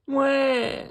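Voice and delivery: high, loud mock baby "Wah!"; toddler wail. A narrator's reading. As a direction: wail out like a little piglet crying. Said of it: "cries George".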